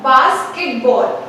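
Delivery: basketball said slowly, bas-ket-ball; slow syllables. Shown as a demonstration. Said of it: "it can be divided into three pronunciations".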